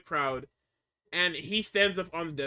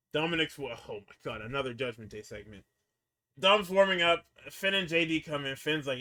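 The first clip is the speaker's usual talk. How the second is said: ordinary talk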